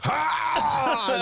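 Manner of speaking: a sudden high maniacal "ha!"; maniac cackle. Retold or imitated. Retelling a friend cackling in the mirror, maniac laugh. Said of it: "He cackled like a maniac".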